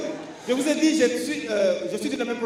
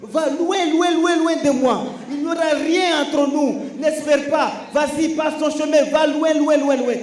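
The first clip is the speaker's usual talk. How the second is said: high, loud, mock dismissal; go away; 7 semitones higher, 5 dB louder than usual. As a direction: shoo him off, shouting. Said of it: "Ce nom voulait dire".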